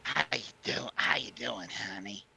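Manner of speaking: raspy whisper. Retold or imitated. Imitating drunk grandma.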